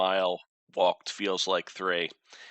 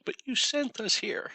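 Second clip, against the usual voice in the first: whiny protest. Retold or imitated. Retold in-character protest.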